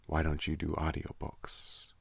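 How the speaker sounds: ordinary talk, nothing marked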